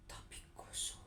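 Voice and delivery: hushed, unvoiced storytelling; very soft, eerie whisper; suspenseful whisper. A narrator's reading. Suspense, hero finds nobody there.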